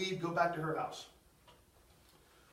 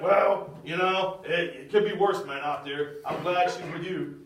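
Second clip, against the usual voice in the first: easygoing dad imitation; 6 semitones lower than usual. Girl's dad accepting the boyfriend, low laid-back voice. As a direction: lower voice of an accepting father.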